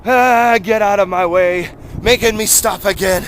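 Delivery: annoyed shout. Real, not acted. Frustrated while running.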